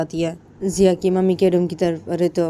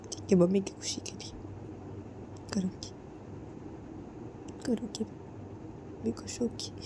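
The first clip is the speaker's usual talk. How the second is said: despairing whisper; 5 semitones higher, 6 dB quieter than usual. Acted. Distraught bride talks of dying.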